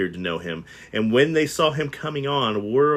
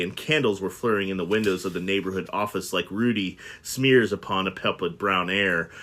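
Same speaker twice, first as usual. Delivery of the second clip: ordinary delivery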